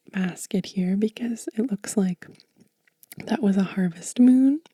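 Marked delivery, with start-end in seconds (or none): none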